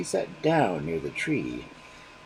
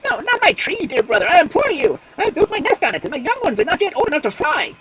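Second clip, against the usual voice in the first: much higher and louder, a frantic bird's screech; screeching plea; 10 dB louder than usual. Acted. Magpie begging the fox to spare her tree, screeching. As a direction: screech it out to save the nest. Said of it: "the magpie screeched loudly".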